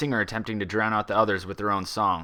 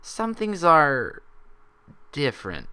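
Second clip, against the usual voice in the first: very slow, halting emphasis; slowly; 6 semitones higher, slower than usual. A narrator's reading. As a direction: stretch it out, then drop. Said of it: "she added slowly".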